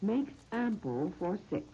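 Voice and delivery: slow, measured phrases with long pauses; recipe dictation. Real, not acted. Radio host reading out a recipe to listeners, slow dictation. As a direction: dictate it piece by piece, leaving time to write.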